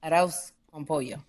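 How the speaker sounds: Arroz con pollo with tapped R and Spanish vowels; Spanish pronunciation. Shown as a demonstration. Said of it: "Just say the stuff the right way".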